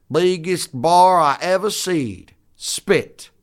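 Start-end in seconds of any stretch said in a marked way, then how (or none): 0.1-2.3 slow, put-on hillbilly brag; hillbilly drawl. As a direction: marvel like a backwoods hunter. Hillbilly sketch dialogue.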